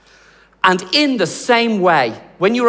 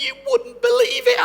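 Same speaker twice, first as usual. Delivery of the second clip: very high, squeaky fake crying; hammed-up weepy squeal; mock tearful breakdown. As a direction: imitate someone overcome with happy tears. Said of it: "in tears and having a breakdown".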